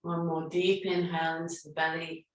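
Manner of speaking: calm, unhurried meditation guidance; guided breathing meditation. Acted. Yoga teacher guiding her online class through breathing, calm and soothing.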